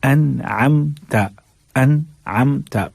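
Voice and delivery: अनअमता slow, even and flat, no stop on म; flat recitation. Shown as a demonstration. Teacher demonstrates a flat, slow reading of अनअमता. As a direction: recite अनअमता flatly and slowly. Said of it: "flat अंदाज में हमें पढ़ के".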